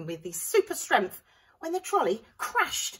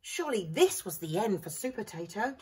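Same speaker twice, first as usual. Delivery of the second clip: ordinary delivery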